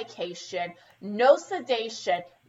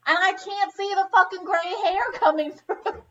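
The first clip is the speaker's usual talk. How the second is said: higher indignant mock rant; hammed-up higher outrage; retold rant; 7 semitones higher than usual. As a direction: rant it back like you did then. Retelling her outburst at stylist.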